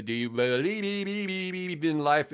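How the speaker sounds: high, slow, warbling robotic sing. Retold or imitated. Guest mocking [name]'s auto-tune, slow and high. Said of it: "Cher was doing the".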